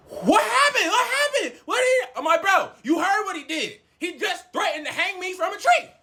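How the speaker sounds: very high-pitched, agitated retelling; acted-out frantic indignation; worked-up outburst. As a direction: exclaim in outraged disbelief. Acts out worked-up exchange with friend.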